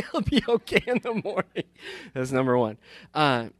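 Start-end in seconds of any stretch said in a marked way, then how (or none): 0.1-1.6 higher, laughing through the words; a dad laughing off his son's injury. Real, not acted. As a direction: giggle at your own carelessness. Laughing at his own dismissal.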